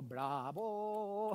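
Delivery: drawn-out mock congratulation; sarcastic bravo. Speaker answering someone doing Kanban without WIPs, sarcastic bravo. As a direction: stretch it out in mock admiration. Sarcastic praise of silly practice.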